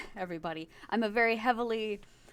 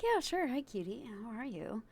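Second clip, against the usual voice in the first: smooth reply; 3 semitones higher, 6 dB quieter than usual. Retold or imitated. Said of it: "And I was like".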